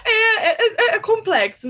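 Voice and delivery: high, loud words through laughter; laughing agreement. Real, not acted. Artist agreeing her huge project is complex, laughing. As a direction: stammer it out while laughing. Laughing after friend's outburst.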